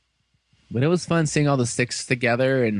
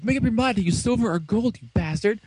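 higher-pitched, indignant retort; mock exasperation; 8 semitones higher than usual. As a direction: act out an annoyed villain scolding. Acted quarrel with indecisive hero.

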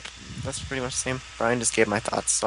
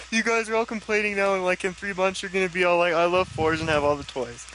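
a hint of a whiny online commenter; lightly mock the commenters complaining; generic commenter voice; 6 semitones higher than usual. Podcaster mocking future commenters, mild whiny commenter voice. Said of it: "That's my generic commenter voice".

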